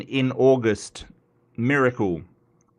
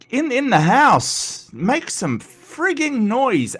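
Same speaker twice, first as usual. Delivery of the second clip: higher, slow, drawn-out ring announcer call; announcer hype; 10 semitones higher, slower than usual. Acted. Streamer plays MC for a viewer, hammed-up announcer. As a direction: stretch every word like a ring announcer.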